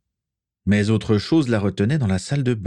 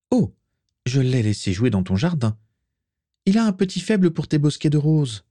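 higher, light teasing character voice; a touch of mischief, rising higher; lightly voiced Vera, teasing; 7 semitones higher than usual. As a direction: needle the host with feigned innocence. Voices Vera teasing her host.

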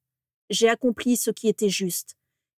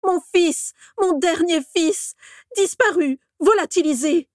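much higher, loud, slow grieving cries; anguished shouting; 10 semitones higher, 5 dB louder than usual. A narrator's reading. Orion cries out about his missing son. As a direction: cry out a father's despair. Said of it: "les cris d'Orion".

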